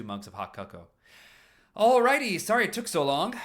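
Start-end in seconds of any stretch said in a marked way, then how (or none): 1.8-3.4 loud, high, cheery call; cheery Paul. Acted. Paul entering with the cocoa, loud and high cheery voice. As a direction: breeze in bright and oblivious.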